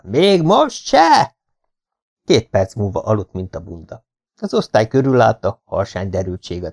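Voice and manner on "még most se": very slow, stretched high yell; drawn-out shout. Acted. Schoolboy yelling at a drugged classmate, slow stretched shout. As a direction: drag every syllable out at full voice. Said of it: "ordítottam".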